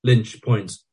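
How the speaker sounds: ordinary delivery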